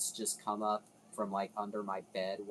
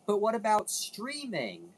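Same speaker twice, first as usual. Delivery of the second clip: higher, louder eager question; imagined viewer question; 9 semitones higher, 3 dB louder than usual. Retold or imitated. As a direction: voice a curious viewer asking. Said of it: "you're probably also thinking".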